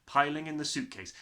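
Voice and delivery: usual speaking voice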